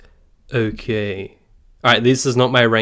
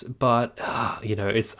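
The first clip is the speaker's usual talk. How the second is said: normal talking voice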